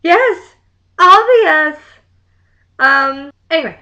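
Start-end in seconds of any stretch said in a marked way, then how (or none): 0.0-1.8 loud, higher, eager agreement; loud gleeful yes to her request. Retold or imitated. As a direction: blurt out an emphatic yes. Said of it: "I said".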